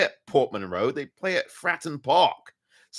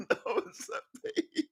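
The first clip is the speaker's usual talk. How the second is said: high, soft words squeezed through laughter; laughing confession; 9 dB quieter, slower than usual. Real, not acted. Fan owning up to his mistake, laughing. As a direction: giggle out the admission.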